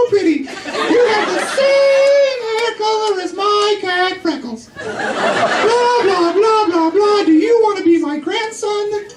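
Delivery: put-on very high, slow gushing old lady. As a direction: squeal it like a doting grandma.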